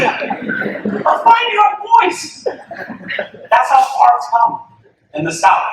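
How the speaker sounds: high, slow words broken by laughter; laughing delight. Real, not acted. Coach laughing to the crowd about the boys, high and slow.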